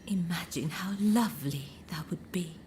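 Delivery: normal talking voice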